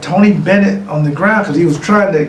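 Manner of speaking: ordinary talk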